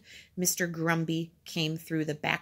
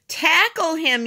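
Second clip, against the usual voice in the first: high, loud urgent shout; raised, urgent holler; yelling; 10 semitones higher, 6 dB louder than usual. A narrator's reading. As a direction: yell an order to your friend. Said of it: "yelled Robert".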